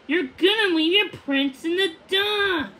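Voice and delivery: pressing his point, voice up high. A narrator's reading. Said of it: "he insisted".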